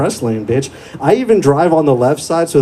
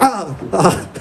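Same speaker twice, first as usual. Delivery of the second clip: mock pained groans; 6 semitones higher than usual. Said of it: "And I was like".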